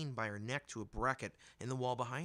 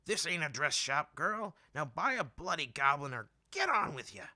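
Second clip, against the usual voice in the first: put-on loud, higher old vendor scolding; hollering old woman; 5 semitones higher, 6 dB louder than usual. A narrator's reading. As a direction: shout down at the girl, impatient. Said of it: "the woman hollered down at her".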